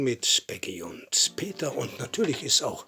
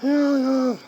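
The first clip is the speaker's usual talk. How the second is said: high, scratchy hen voice with quacks; squeaky puppet hen chatting to children; Peggy; 7 dB louder than usual. Pretend.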